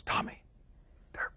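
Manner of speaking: hushed, halting whisper, imitating a dad; stunned whisper. Dad telling his son about the pay, halting whisper. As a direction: whisper it like news too big to say aloud.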